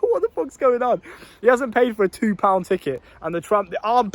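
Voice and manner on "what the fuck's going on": high, laughing disbelief; what the hell is this, squeaked out; shocked outburst. Retold or imitated. Narrator shocked at the armed police chase, laughing disbelief.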